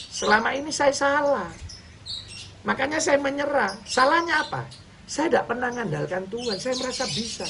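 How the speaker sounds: high, pleading preacher's cry; impassioned confession. Real, not acted. Preacher confessing his mistake to the congregation, impassioned and high.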